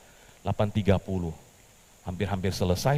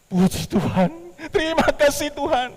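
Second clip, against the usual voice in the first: much higher, louder thanks; joyful praise; 7 dB louder than usual. Acted. Petrus thanking God after the prize call, overjoyed.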